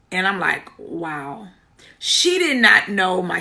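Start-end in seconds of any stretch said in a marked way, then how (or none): 0.8-1.5 very soft, stunned murmur; retold stunned reaction. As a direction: retell being taken aback, barely voiced.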